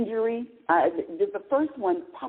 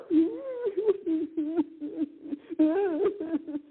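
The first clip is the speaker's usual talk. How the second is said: high, drawn-out mock whimper; little whimper; 10 semitones higher than usual. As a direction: imitate yourself whimpering in little pulses. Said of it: "a, a little whimper".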